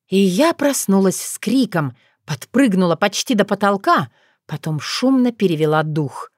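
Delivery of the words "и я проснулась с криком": ordinary delivery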